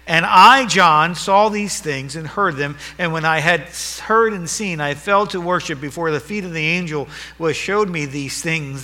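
ringing pulpit proclamation; preaching at full force. Acted. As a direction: declare it to the whole church. Reads scripture aloud.

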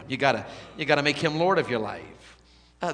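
usual speaking voice

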